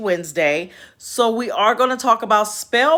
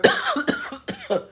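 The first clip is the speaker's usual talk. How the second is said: real choking cough from laughing; cough, choking mid-laugh; 7 semitones higher, 5 dB quieter than usual. Real, not acted. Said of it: "I'm choking 'cause I'm laughing".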